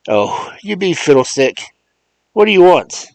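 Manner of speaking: high and loud, brusque and irritated; impatient Mars John. Acted. Mars John scolds Remus down the phone, impatient. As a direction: snap at the old man to hurry.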